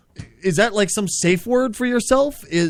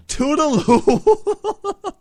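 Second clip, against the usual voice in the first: puzzled drawl; 7 semitones higher, much slower than usual. Real, not acted. Host puzzled repeats catchphrase.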